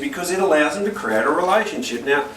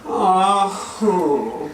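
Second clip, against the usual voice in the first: a put-on child's sulky sigh; mock sulky child; 4 semitones higher than usual. Four-year-old moping near mum in the kitchen, mock sulky sigh. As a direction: play the moping little boy. Son fishing for sympathy.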